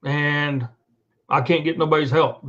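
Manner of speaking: ordinary talk, nothing marked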